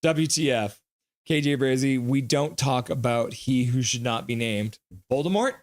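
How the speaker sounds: ordinary talk, nothing marked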